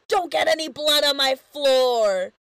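loud indignant yell, retold. Lube yelling about Fred fallen on the lobby floor. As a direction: yell it over the whole lobby. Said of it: "and Lube yells".

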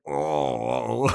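low, drawn-out mock groan; hammed-up pained moan; Giles. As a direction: groan limply like a wounded man lying down. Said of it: "Giles is laying down like".

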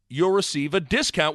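ordinary talk

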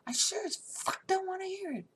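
high, exasperated finish; angry outburst. Retold or imitated. Bride refusing groom's story, high angry outburst. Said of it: "I was so angry".

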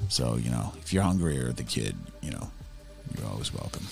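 low and soft, kindly; soft reassuring. Acted. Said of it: "I'm like".

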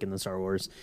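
usual speaking voice

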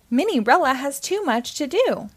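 ordinary delivery, nothing marked

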